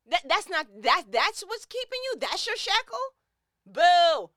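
rising high, mocking disbelief then a loud boo; incredulous scorn. Real, not acted. Scoffing at viewers who stay stuck. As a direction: scoff at their excuse. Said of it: "'Cause I'm irritated".

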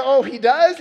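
dad playing along with son; mock surprise. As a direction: feign amazement.

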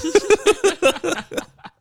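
high, drawn-out real laughter; laughing. Real, not acted. Host cracking up over friend who can't take losses, high laugh. Laughs at Jazz unable to lose.

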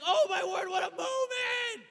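high, loud, slow cry of wonder; excited exclamation. Retold or imitated. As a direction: marvel out loud at the big moment. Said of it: "I got excited".

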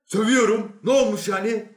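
high, loud defiant retort; loud defiance. Acted. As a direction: throw it back at him loudly. Newcomer defies the inspector.

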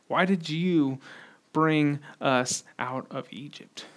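quiet, low, slow accusation; restrained reproach, drawn out word by word; understated angry accusation. Acted. Wandering Hebrew people accusing Moses, quiet slow reproach. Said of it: "is just filled with anger".

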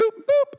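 high, short "boop"; sudden high-pitched boop; playful mimicked reset-button noise; imitated button beep. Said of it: "And she's like".

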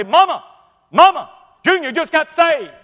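high, loud, overjoyed calling out; excited shouting. Retold or imitated. Old preacher running through the house, overjoyed loud shout. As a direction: cry out the news to his wife.

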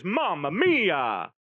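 slow, savoured Italian "Mamma mia"; lightly hammed-up Italian accent; Italian exclamation. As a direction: linger on it like an admiring Italian. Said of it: "the Italian translation is".

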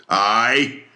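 high, rising anguished cry; loud sobbing shout. Acted. Ahab shouts of his lost leg, loud animal sob. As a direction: let it burst out like a wounded animal. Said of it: "he shouted with a terrific loud animal sob".

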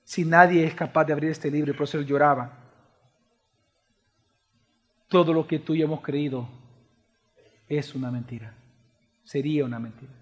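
very low, soft sermon voice with long pauses; hushed, grave preaching. Real, not acted. Preacher voices the unthinkable to the church, grave hush. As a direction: let each bleak statement sink in quietly.

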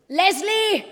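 sharp loud call of a name. Acted. Boss summoning her reporter, loud call. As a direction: bark the name down the phone.

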